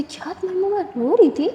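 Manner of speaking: can't believe what she just heard; sudden worried disbelief in a high voice; shocked question. Acted. Hears her friend cried overnight.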